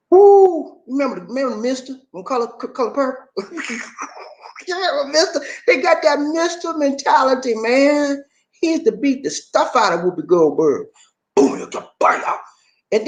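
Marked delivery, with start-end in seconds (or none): none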